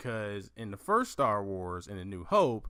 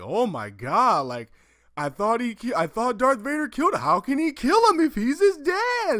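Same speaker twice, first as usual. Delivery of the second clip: much higher, louder disbelieving squeal; high, loud stunned disbelief; shocked kid; 11 semitones higher, 8 dB louder than usual. Retold or imitated. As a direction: relive the childhood shock. Retelling childhood shock.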